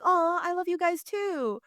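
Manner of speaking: touched and returning the affection; normal sweet reply. Retold or imitated. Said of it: "a normal person was like".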